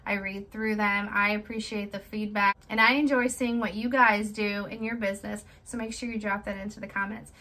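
normal talking voice

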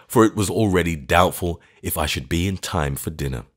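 ordinary delivery, nothing marked

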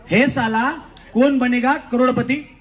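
loud, slow, deliberate filmy line; Amitabh Bachchan dialogue. Shown as a demonstration. Host showing the contestant how to say the line, loud and slow. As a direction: say it like a film star making an entrance. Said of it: "व्यवस्थित ऐका वहिनी".

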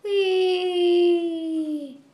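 high, loud gleeful whee; excited whee. Real, not acted. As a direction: squeal with delight on the ride. Cart ride starts.

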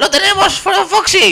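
very high-pitched triumphant cry; excited cheer. Real, not acted. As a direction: shout to a teammate in elation. Celebran que lo consiguen. (grito agudo de euforia)

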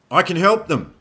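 high, loud plea; yelled insistence; screamed protest. A narrator's reading. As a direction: shout it desperately over the battle. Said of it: "Roy screamed".

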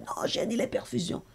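hushed, breathy warning; hushed nurse's warning at the bedside; urgent whisper. Retold or imitated. Staff quoted urgently at bedside.

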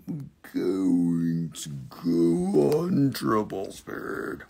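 joking slow-motion drag, stretching every word; ironic slow motion. Shown as a demonstration. Host teasing viewers about speed, in mock slow motion. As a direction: say triple speed at a crawl, fading out.

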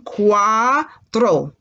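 "Cuatro" stretched, split into cua and tro; "Cuatro" slowly, in two separate syllables. Shown as a demonstration. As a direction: draw out "Cuatro", landing on "tro" like "throw". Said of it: "Like you're throwing something".

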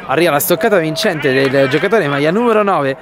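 ordinary talk

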